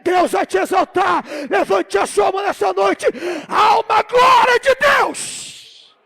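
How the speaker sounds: loud, driving shout building to a long held cry; full-force preaching. Real, not acted. Preacher blessing the congregation that God will prosper them, full-force shout. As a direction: shout blessings over them, rising and swelling to the end.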